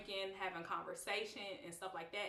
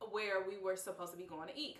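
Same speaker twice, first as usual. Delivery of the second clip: ordinary talk, nothing marked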